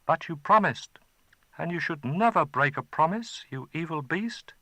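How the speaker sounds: put-on a betrayed child pleading; boy reproaching the dragon; hurt boy. Boy protests.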